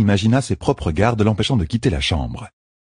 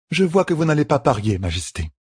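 higher, steady caregiver's voice; firm midwife; 8 semitones higher than usual. A narrator's reading. Midwife explains the treatment to the queen, firmly. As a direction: lay out the rules kindly but firmly.

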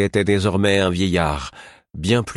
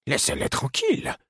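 put-on higher, softer stern old woman; stern murmur; 12 semitones higher, 3 dB quieter than usual. A narrator's reading. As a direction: scold under the breath. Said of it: "murmura-t-elle".

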